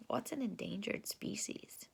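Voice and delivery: soft whispered question; hushed teacher question. Real, not acted.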